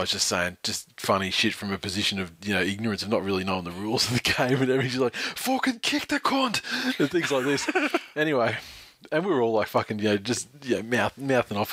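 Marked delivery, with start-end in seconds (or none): 5.1-6.6 high, slow Irish heckle; bad Irish accent. Irish guy who knows no rules at the footy, bad Irish accent. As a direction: shout advice in a hammed-up Irish accent. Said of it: "In shitty accents".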